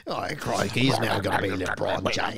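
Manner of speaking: put-on comic Australian accent. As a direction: bring back the fake Aussie voice. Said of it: "Can you do an Australian accent".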